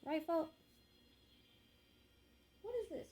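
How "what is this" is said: startled and much higher, what is this; sudden surprise at a strange noise; surprised. Real, not acted. Reader hears an odd sound, startled high question.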